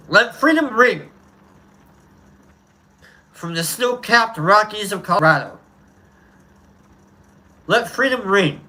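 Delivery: rising, ringing preacher-style delivery with long pauses; oratory speech reading. Acted. Streamer reading the speech to his chat, orating. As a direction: build each "let freedom ring" like a civil rights orator. Recites the famous freedom speech.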